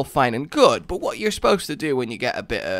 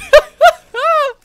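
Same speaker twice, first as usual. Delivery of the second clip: burst of laughter; 13 dB louder than usual. Real, not acted. Laughs at own joke.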